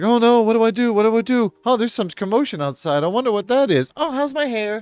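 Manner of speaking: high-pitched, fluttery mock damsel voice; airheaded falsetto fussing; ditzy fretting woman. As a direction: play a vain damsel in a comic panic. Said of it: "she's just like".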